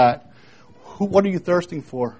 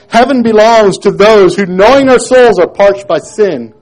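louder, higher preaching; 7 semitones higher, 10 dB louder than usual. Shown as a demonstration. Preacher declares to the congregation, loud. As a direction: drive the line home at full force. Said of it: "Listen, heaven belongs to those who".

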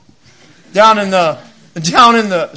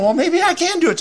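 higher, hesitant; hopeful musing; 9 semitones higher than usual. Retold or imitated. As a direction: talk yourself into it. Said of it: "I was going".

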